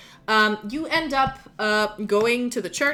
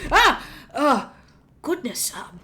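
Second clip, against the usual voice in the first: startled gnome bishop. Acted. Gnome bishop startled by a head on his desk, gasping. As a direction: jump back in shock at the head.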